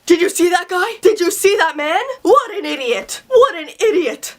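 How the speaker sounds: mildly hammed-up indignant rant; mad grandpa. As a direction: act out an angry grandfather, lightly. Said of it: "my grandpa got so mad".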